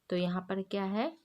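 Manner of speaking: usual speaking voice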